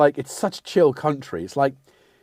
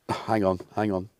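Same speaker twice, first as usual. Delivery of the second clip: usual speaking voice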